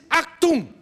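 a loud, high alarmed shout; startled exclamation. Acted. German spy woken by a soaking, shouting in alarm. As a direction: snap it out in sudden alarm. Startled awake by cold water.

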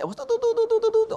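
high censor-beep hum; high do-do-do bleep noise; mock beep. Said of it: "All the expertise came out".

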